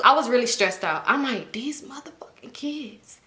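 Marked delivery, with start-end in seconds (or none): 1.5-3.2 slow, hushed, fed-up whisper; exasperated whisper. Retold or imitated. Aunt stressed out by kids' prank, whispering slowly. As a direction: whisper each word slowly in annoyance. Stressed, muttering about kids.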